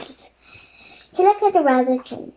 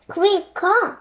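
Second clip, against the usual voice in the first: higher, louder urgent call; 7 semitones higher, 4 dB louder than usual. Acted. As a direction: summon the guard sharply. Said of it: "he called to one of his bodyguards".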